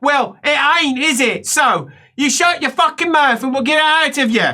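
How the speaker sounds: high-pitched, stern telling-off; bossy helper. Acted. Helper silencing the patient, acted bossy scolding.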